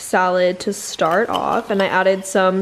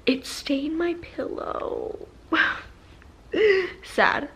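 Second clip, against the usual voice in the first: mock sad; 8 semitones higher, 5 dB quieter, slower than usual. Pillow stained, mock sad.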